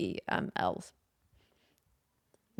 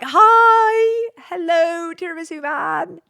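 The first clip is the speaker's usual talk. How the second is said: much higher and louder, a squeaky bright greeting; high sing-song reply; 10 semitones higher, 11 dB louder, much slower than usual. Retold or imitated. Answering the tiramisu man's message, a high sing-song mock greeting. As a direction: chirp it back in a very high voice. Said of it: "And I'm like".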